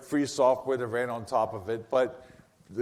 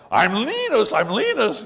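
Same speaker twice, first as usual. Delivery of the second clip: loud, high protest, Linus as "LEE-nus"; raised pitch and volume, hammering the name; mock insistence; 8 semitones higher, 6 dB louder than usual. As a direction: imitate someone pompously insisting on their name. Mimics people insisting on their name.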